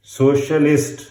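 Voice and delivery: ordinary talk, nothing marked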